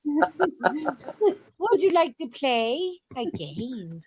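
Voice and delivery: slow, laughing creepy voice. Pretend. S0 keeps up the creepy clown game, laughing. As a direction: draw the words out like a horror villain.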